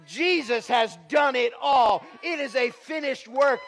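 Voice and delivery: loud, high proclaiming shout; preaching shout. Acted. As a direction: hammer home the finished work. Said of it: "preacher shouts the climax".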